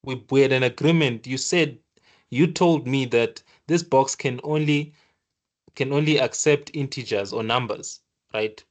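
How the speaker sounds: ordinary delivery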